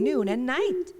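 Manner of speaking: ordinary delivery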